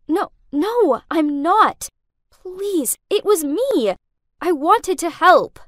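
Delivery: high, distressed begging; upset, urgent plea; desperate pleading. A narrator's reading. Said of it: "I pleaded".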